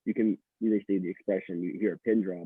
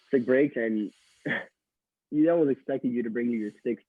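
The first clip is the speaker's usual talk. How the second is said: ordinary talk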